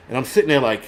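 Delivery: ordinary delivery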